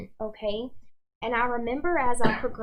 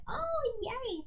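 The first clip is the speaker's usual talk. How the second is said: delighted squeal; 12 semitones higher, 7 dB quieter than usual. Retold or imitated. Excited reply on receiving gift.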